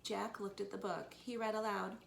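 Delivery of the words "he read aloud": ordinary delivery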